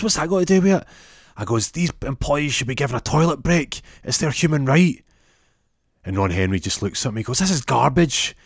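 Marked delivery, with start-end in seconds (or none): 0.0-0.9 high, loud acted challenge; indignant retort. Boss challenges the inspector. 1.7-5.0 high-pitched, emphatic acted protest; indignant insistence. Inspector argues for worker rights. 7.4-8.3 loud, high scornful retort; acted angry dismissal. Boss rejects the inspector's demand.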